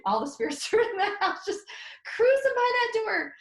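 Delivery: high, laughing, rising excitement; laughing disbelief. Real, not acted. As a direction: giggle through the wonder of it.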